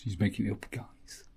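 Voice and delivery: unvoiced whisper to viewers; conspiratorial whisper aside. Real, not acted.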